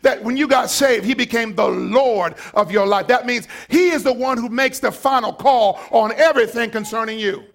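loud, high shouting sermon; full-force preaching. Acted. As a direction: preach it hard to the congregation.

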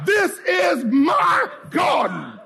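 high, slow shout with "my" stretched; drawn-out rallying cry, "garden" falling away; emphatic declaration. Shown as a demonstration. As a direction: proclaim it word by word, peaking on "my". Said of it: "keep doing this till you get it right".

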